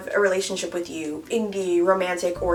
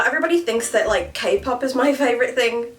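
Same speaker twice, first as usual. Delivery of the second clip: normal talking voice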